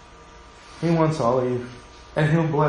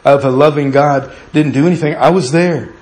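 loud, ringing pulpit preaching; full-force preaching; 5 dB louder than usual. Acted. Pastor preaching boldly to the congregation, loud and fervent. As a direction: preach it out to the whole church.

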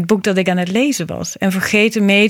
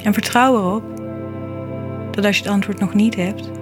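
soft spa whisper; 5 dB quieter than usual. Acted. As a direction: read it gently like a calming mantra. Reflective poem read over music.

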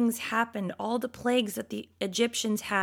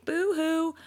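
mock boo-hoo crying; 6 semitones higher than usual. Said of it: "a bunch of whiny babies".

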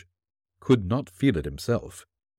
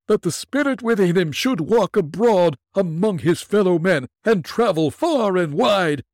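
unearthly voice; 12 semitones higher, 5 dB louder than usual. Acted. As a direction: moan like a doomed spirit. Ghost laments in high voice.

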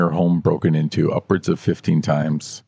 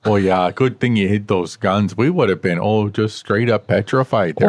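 folksy mock-Minnesota cop; Minnesota accent. Officer thanking the man who hid guns, mock Minnesota accent. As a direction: exaggerate the Minnesota vowels. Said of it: "the most Minnesota-sounding accent".

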